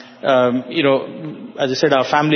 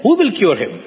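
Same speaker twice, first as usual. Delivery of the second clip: raised pitch, retold disbelief; incredulous question; 6 semitones higher than usual. Father to holy man about his son's cure, incredulous. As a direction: challenge him as the doubting father.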